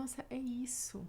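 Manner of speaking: whispered quote. Retold or imitated. Quoting people reacting to her posts.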